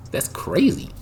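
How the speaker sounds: usual speaking voice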